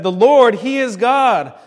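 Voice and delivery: loud, emphatic acclamation; crowd proclaiming. Acted. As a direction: proclaim it at full preaching force. Crowd's awed cry, quoted.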